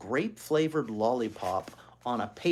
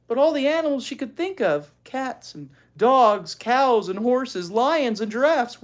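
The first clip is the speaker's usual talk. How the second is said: high, loud listing of animals; animated storyteller list; excited list; 11 semitones higher, 8 dB louder than usual. A narrator's reading. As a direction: rattle off the animals with gusto.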